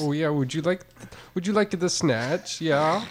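Irish attempt drifting toward Swiss or Dutch; Irish accent. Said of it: "it's an Irish immigrant to, who immigrated".

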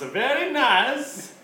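very slow, high Borat "Very nice"; drawn-out Borat catchphrase, high; Borat impression. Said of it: "everyone goes".

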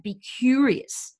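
Be curious drawn out slowly; slow emphasis. Shown as a demonstration. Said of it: "ask them the reason".

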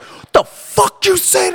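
high, slow furious scream at a customer. Retold or imitated. Bar owner screaming at a rude customer who cursed him. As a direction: scream it at the top of your lungs. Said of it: "I'm screaming at the top of my lungs".

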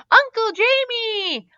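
put-on high, drawn-out child's call; excited, high-pitched kid's cry; yell. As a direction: call out in delight to an uncle. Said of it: "Leo yelled".

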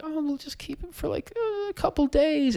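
light, high sing-song coo; cooing reported excuse. Retold or imitated. Owners talking themselves into keeping the puppy, cooing. Retold cutesy plan to keep puppy.

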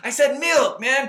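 high, loud, laughing insistence; indignant protest. Real, not acted. Co-host defending how he said milk, indignant. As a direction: protest loudly, pitch shooting up, half laughing. Mock quarrel over pronunciation.

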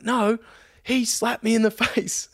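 high, drawn-out mock wail; protesting passenger. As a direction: imitate her aggrieved protest with a laugh breaking in. Imitating the irate passenger protesting.

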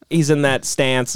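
ordinary talk

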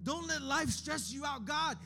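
ordinary delivery

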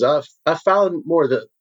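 high, eager host voice, pitched well up; smooth guild boss Moranis. Acted. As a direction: play the slick host showing off a new client. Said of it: "and says".

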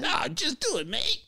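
high, gravelly fake Aussie; badly faked, gravelly Australian accent. Shown as a demonstration. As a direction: growl it like a cartoon Australian. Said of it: "Why does your voice get gravelly".

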